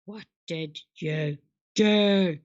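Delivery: low, slow drawn-out question; distorted low slow take; slowed low voice effect. Pretend.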